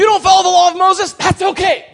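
high, loud preacher's shout; exuberantly loud, pitched right up; shouted proclamation. Acted. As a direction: holler the reassurance to the room. Acted proclamation of the gospel message.

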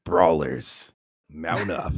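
low, rallying battle cry; Regulators call to arms. Pretend. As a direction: call the crew to ride out, pitched low. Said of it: "I was doing the regulators thing".

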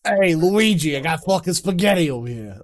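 put-on loud, jokey Italian-American Mario accent. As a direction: ham up a New York-Italian plumber. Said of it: "like that".

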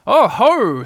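sudden loud, high exclamation; loud storybook "aha". A narrator's reading. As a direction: burst out with a startling call. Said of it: "said Christopher Robin loudly and suddenly".

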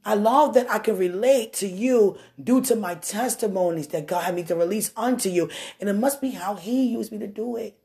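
ordinary delivery

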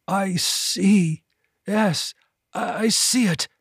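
high, rasping, halting realization. A narrator's reading. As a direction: rasp out a slow dawning vision. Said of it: "The Hargath rasped".

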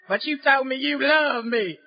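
slow, low country drawl. Retold or imitated. Lovesick man pleading in a slow country drawl. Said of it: "in the most country voice you can imagine".

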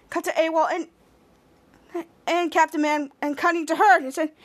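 normal talking voice

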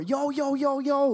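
high, rapid-fire hailing; calling through door. Retold or imitated. Older friend at the bathroom door, high-pitched calling.

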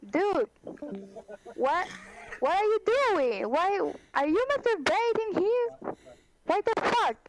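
retold outraged shout at a stranger. Confronting the masturbating man.

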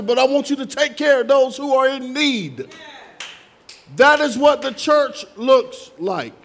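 full-force preaching. Real, not acted. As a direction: proclaim it forcefully from the pulpit.

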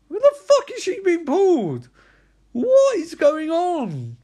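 high, loud, exasperated disbelief; stretched-out, incredulous yell; baffled outburst. Real, not acted. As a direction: cry out in total bewilderment. Baffled by the film's plot.

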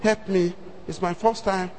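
soft, low pleading repeat of my message; murmured panicked plea; quiet desperate prayer. Retold or imitated. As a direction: beg under your breath. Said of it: "I was praying".

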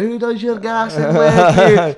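slow, high, loud drawn-out shout; mock salesman hollering from a passing car; yelling out window. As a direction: bellow it slowly at passers-by.

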